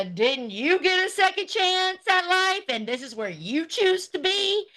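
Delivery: high, sing-song taunt; mocking echo. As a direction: parrot her question back at her, pitched high. Throws rival's quote back at her.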